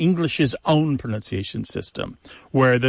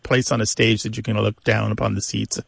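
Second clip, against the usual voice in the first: ordinary delivery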